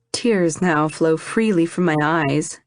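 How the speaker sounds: ordinary delivery, nothing marked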